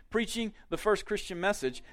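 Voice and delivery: ordinary talk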